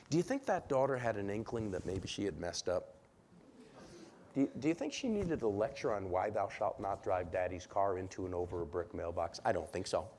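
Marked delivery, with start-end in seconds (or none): none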